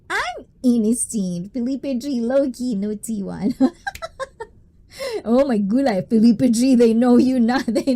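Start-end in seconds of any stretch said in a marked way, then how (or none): 3.6-4.4 squeaky high-pitched giggle; a quick giggle. Real, not acted. Laughs at chat.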